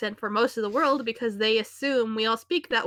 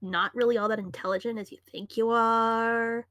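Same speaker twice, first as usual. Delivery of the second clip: put-on Valley Girl accent. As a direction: stretch endings like an insecure Valley Girl. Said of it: "that means that you're from the Valley".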